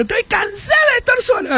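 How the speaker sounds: high, shouted falsetto complaint of a lonely wife; hammed-up high whiny wife; mock woman's voice. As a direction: squeal it like a fed-up wife. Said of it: "la mujer dice".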